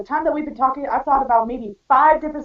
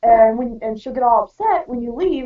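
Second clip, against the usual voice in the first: usual speaking voice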